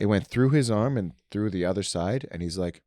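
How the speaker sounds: ordinary talk, nothing marked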